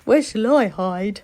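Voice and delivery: the fox looking for cover; anxious fox voice; worried fox. Acted.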